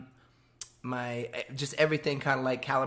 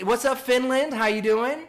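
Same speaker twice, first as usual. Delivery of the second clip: excited greeting; 10 semitones higher, 7 dB louder than usual. Real, not acted. Greeting fans on stream.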